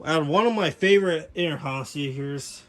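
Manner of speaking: normal talking voice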